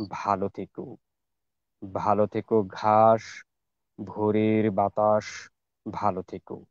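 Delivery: slow, measured verse with drawn-out line endings and long pauses; poem recitation. Shown as a demonstration. Reciter offers a famous farewell poem to the gathering, slow measured recitation. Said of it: "চেষ্টা করছি আবৃত্তি করার".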